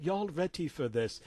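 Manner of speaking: put-on British accent; distinguished British accent. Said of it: "he said in his distinguished British accent".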